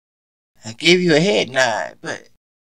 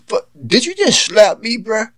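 higher, shocked after a slap; just got slapped; 6 semitones higher than usual. Acted. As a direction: react stunned to being slapped.

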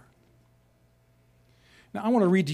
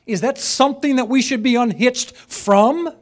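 rising, louder impassioned question; real passion; 7 semitones higher, 7 dB louder than usual. Real, not acted. Preacher to congregation, rejecting the pastor's quote, passionate. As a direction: let the passion break through the question. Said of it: "I betray my passion, forgive me".

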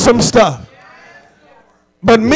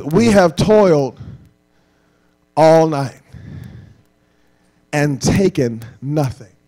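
lower, softer, slow and tired; weary frustration; 8 semitones lower, 4 dB quieter, slower than usual. Retold or imitated. Peter telling Jesus of a fruitless night, lightly frustrated. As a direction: sigh it out like a worn-out fisherman. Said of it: "he's frustrated".

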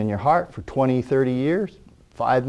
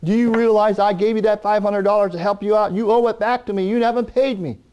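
arguing debtor; 7 semitones higher than usual. Retold or imitated. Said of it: "He goes".

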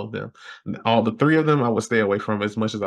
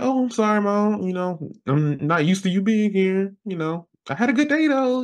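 much higher, sugary mock apology; mock-polite reply; 9 semitones higher than usual. Son answering his snapping mom, in a high mock-sweet voice. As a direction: play innocent with a sing-song high lilt.

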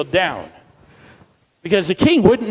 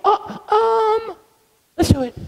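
put-on hesitant kid voice; slower than usual.

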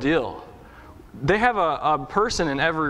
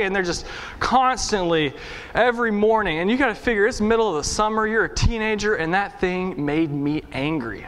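ordinary delivery

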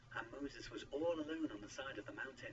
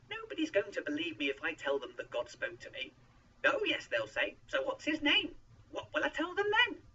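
loud objection climbing very high; insistent; 12 semitones higher, 8 dB louder than usual. A narrator's reading. Moses argues nobody will believe him, insistent and rising. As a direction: build to a high, loud, exasperated question. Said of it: "Moses insisted".

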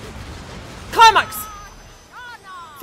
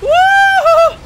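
very high, loud held whoop; victory whoop; 10 dB louder than usual. Real, not acted. Player whooping after beating an enemy, triumphant. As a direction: let out a triumphant cry.

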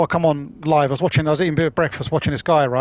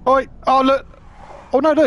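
sudden high excited cry; surprised outburst; 8 semitones higher, 3 dB louder than usual. Real, not acted. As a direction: blurt it out in alarm. Reacting to a driver in game.

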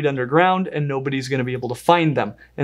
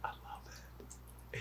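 barely audible slow whisper; hushed; 30 dB quieter, much slower than usual. Real, not acted. Host handling the vinegaroon, hushed whisper. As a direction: whisper it under your breath. Hushed aside over the animal.

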